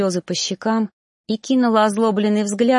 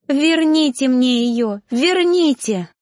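slow, high, raised cry climbing on the repeat; anguished plea; 5 semitones higher, 4 dB louder, slower than usual. A narrator's reading. Mother begging for her dead child back, crying out. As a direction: demand it back in desperate grief. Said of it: "кричала мать".

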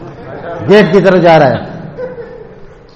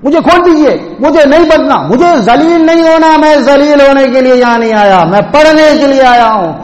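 higher, louder indignant protest; 8 semitones higher, 3 dB louder than usual. Retold or imitated. The bound student protests to the teacher, indignant and loud. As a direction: complain loudly at the punishment. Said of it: "احتجاج کرنے لگے".

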